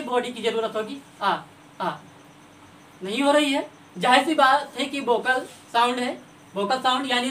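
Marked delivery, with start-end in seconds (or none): 1.2-1.9 short open "आ" repeated twice; sounding the vowel a. Shown as a demonstration. Teacher asks kids to try the vowel, demonstrating. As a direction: give "आ" twice, open and clean. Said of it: "आ बोल करके देखिए".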